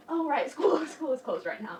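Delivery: high, loud sudden realization with a laugh; mock kid surprise. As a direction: play the kid remembering school is off. Kids answering door, school closed.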